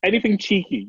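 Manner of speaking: ordinary talk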